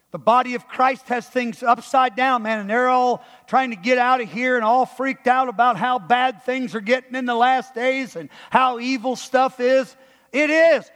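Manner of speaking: high, loud, driving sermon; full-force preaching. Real, not acted. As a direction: preach it hard and defiantly.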